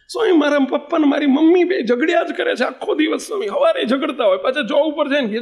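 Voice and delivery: put-on high, tearful child's plea; crying child. Seven-year-old boy begs swami about quarrelling parents, high and tearful. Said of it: "પેલો બાળક રડતો રડતો".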